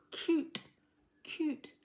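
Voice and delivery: CUTE said as a single burst; one syllable. Shown as a demonstration. Teacher showing a child that CUTE is one syllable, one burst. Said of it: "one burst of sound".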